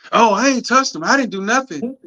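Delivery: put-on high, whiny little boy protesting; whiny kid denial. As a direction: play the kid swearing he didn't touch it. Son voiced denying, higher pitch.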